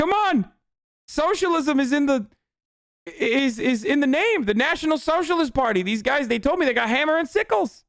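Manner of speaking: mocking impression. Mocking Greenwald and Dore praising militias, high goofy voice. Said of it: "Glenn Greenwald and Jimmy Dore talking about".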